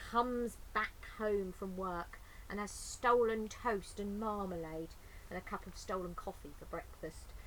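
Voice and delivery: ordinary delivery, nothing marked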